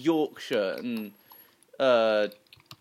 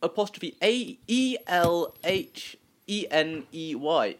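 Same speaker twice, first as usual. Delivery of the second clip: slow, letter by letter spelling; slow careful spelling of a name; 2 semitones higher, slower than usual. Shown as a demonstration. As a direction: spell out each letter slowly. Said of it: "not easy for you to pronounce".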